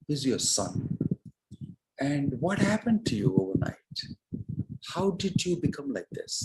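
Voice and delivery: acting out a baffled son; very confused. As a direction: question her in mild disbelief, pitch swooping up. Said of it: "Bharat became very confused".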